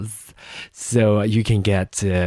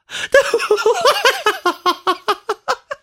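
squeaky giggle fit; high giggle. Real, not acted. Still laughing at her taxes attempt, squeaky giggle.

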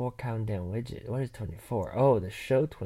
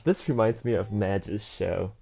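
ordinary talk

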